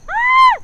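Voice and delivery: sharp high startled yelp; sudden yelp. Real, not acted. Sudden yelp mid sentence.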